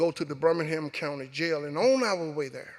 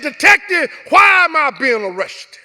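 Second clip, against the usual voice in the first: louder, higher, angry question; angry demand; 9 semitones higher, 12 dB louder than usual. Retold or imitated. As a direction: demand an answer, voice raised in anger. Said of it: "a little louder and a little angry than I should have".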